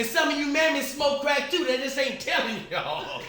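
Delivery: high, loud mock sermon; defiant tirade. Aunt turns accusation on teasing kids, high loud rant. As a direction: imitate an aunt calling everybody out. Aunt's acted tirade at schoolkids.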